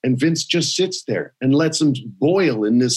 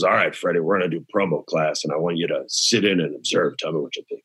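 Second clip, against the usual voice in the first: low, soft gravelly-free boss tone dropping lower; a hint of Vince, low and quiet; 10 semitones lower, 5 dB quieter, faster than usual. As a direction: imitate the boss lowering his voice. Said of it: "And he goes".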